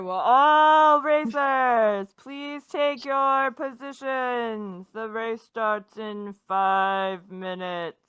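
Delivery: slow, loud announcer call; race announcer. Acted. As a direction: announce it over the loudspeaker, slowly. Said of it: "And then you hear".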